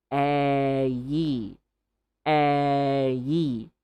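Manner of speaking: short "Eh" then glide to "yi"; vowel drill, eh into yi. Shown as a demonstration. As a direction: split the vowel into eh and yi. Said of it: "just short E".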